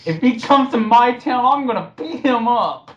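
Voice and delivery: squeaky fake menace, played for laughs; mock tough-guy threat. Co-host parodying a troll's threats, hammed-up high voice.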